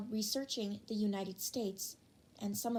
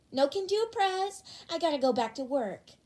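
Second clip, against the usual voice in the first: much higher, louder teasing voice; cheeky classmate; 5 semitones higher, 6 dB louder than usual. Acted. Prankster refusing to change Ida's name back, high cheeky voice. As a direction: tease the class president. Playful reply to Ida.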